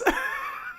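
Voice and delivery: squealing high laugh; a shrill burst of laughter. Real, not acted. Incredulous laughing rant.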